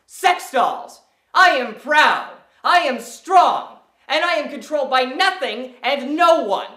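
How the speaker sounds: loud, high, drawn-out declaration; defiant proclamation. Acted. Speaker declaring their independence, loud and defiant. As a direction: proclaim it proudly, punching each phrase.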